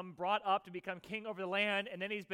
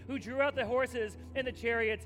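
louder, driving sermon voice of God's promise; preaching at full force; 2 semitones higher, 4 dB louder than usual. Acted. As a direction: proclaim it boldly to the congregation.

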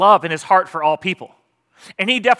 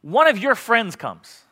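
ordinary delivery

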